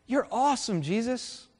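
high, bright cheer for a hero; mock crowd cheer. Preacher voicing the crowd cheering Jesus, high and gushing. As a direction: cheer him on like a fan.

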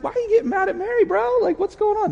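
Holy Spirit as a chummy bro; casual buddy. Retold or imitated. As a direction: tease him like a pal. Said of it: "The Holy Spirit doesn't talk like that".

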